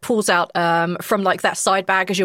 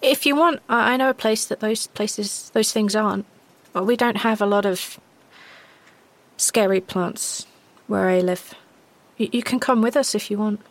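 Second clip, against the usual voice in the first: lightly voiced girl, hesitant; tentative offering girl; 3 semitones higher than usual. Girl invites the stranger home, tentatively. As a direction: make the offer carefully, with pauses.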